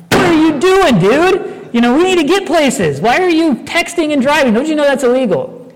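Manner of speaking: mock indignant rant at a guy texting at a green light; retold indignant outburst. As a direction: play the self-righteous driver yelling at the texter. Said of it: "And I was indignant".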